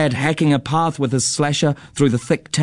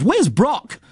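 high, exasperated demand; father's acted yell; yelled question; 9 semitones higher than usual. A narrator's reading. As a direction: demand it with pitch thrown up. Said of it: "yelled Dad".

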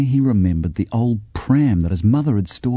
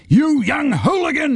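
put-on high, far-off angry bellow at a boy; bellowing neighbour. A narrator's reading. Neighbour in his garden bellows at the boy. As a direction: shake a fist and bellow. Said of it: "he bellowed".

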